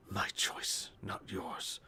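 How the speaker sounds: hushed, whispered mumble; muttering to himself. Acted. Man lost in gibberish, muttering coherent scraps to himself. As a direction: mutter it under your breath, half-coherent. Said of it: "you catch little coherent lines".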